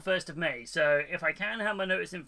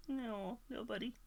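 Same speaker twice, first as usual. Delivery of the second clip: higher, softer coo at a little cat; 7 semitones higher, 10 dB quieter than usual. Real, not acted. Talking to the cat.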